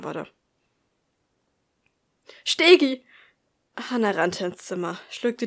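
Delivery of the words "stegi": high, loud call of the name as she bursts in; shouted name. A narrator's reading. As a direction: burst in yelling his name. Hannah bursts in calling out.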